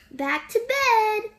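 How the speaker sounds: playful yelled line. A narrator's reading. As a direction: call it out brightly. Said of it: "yelled Ted".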